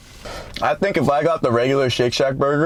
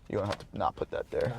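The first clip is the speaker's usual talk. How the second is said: whispering; 4 semitones lower, 13 dB quieter, faster than usual. Real, not acted. Aside to friend off mic.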